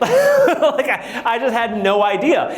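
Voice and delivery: laughing through "I just had no idea". Real, not acted. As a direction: chuckle at your own naivety. Laughing at himself.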